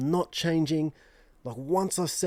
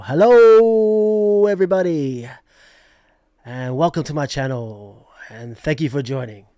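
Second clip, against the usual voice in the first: Abs's intro; 12 dB louder than usual. Retold or imitated. YouTuber borrowing another host's magic, booming showman's intro. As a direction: open with a booming stretched greeting, then ease down. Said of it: "steal Ab's magic and just go".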